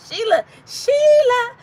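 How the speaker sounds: high, loud, slow Sheila, Sheila; mock calling. Streamer to viewers, mock-calling Sheila in a high put-on voice.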